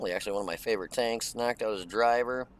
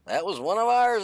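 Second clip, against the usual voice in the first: higher and louder mock radio callout; imitating game voice; 10 semitones higher, 7 dB louder than usual. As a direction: parrot the game's warning. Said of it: "is that I'm like".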